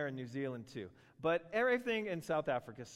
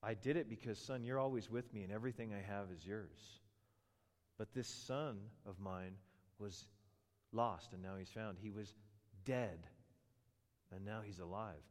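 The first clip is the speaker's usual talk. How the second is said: lower, softer reassuring father; tender father; 5 semitones lower, 7 dB quieter than usual. Retold or imitated. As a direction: gently comfort the older son. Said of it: "has the father say to him".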